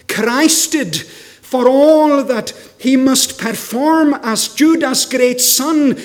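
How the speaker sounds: loud, ringing sermon delivery; full-force preaching. Acted. Preacher asks the congregation why Jesus came, preaching at full force. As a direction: preach it out to the whole hall. Preaching rises about Judah's son.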